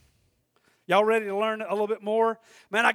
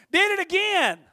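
usual speaking voice

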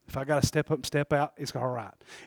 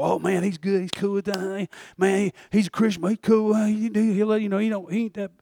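higher-pitched mock chatter of approving people; mimicked worldly approval; 5 semitones higher than usual. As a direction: parody their easygoing endorsement in a raised voice.

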